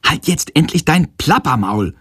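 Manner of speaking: slightly too loud. A narrator's reading. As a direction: blurt it out in irritation, without a full shout. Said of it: "ruft er aus Versehen etwas zu laut". (genervt und etwas zu laut herausgeplatzt)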